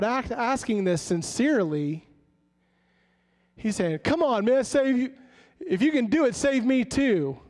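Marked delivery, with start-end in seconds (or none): 4.0-7.4 a scornful thief sneering at Jesus; sarcastic taunt. Retold or imitated. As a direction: taunt him from the cross. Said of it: "he is sarcastically".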